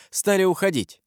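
ordinary delivery, nothing marked

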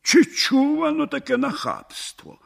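high-pitched, mildly indignant exclamation. A narrator's reading. Village headman reacts to the man wanting his post, indignant. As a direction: voice lifts high in affronted disbelief. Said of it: "обурився".

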